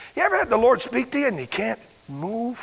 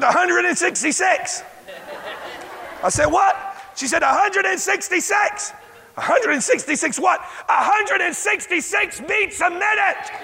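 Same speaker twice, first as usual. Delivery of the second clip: much higher, rising disbelief; higher, rising, near-shouted excitement; excited retelling; 10 semitones higher than usual. Retold or imitated. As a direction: relive the joyful news. Retold phone call, excited.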